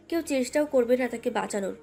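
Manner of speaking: ordinary delivery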